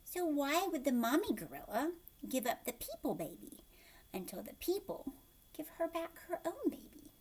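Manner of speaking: plain reading voice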